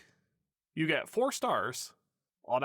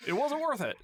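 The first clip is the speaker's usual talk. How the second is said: laughing; 11 semitones higher than usual. Real, not acted. Laughing at own bit.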